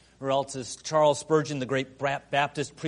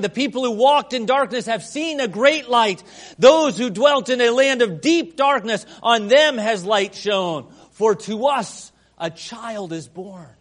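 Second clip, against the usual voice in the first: higher, louder proclaiming; proclaimed scripture; 5 semitones higher, 8 dB louder than usual. Acted. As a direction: ring it out to the back pew. Reads Isaiah 9 aloud.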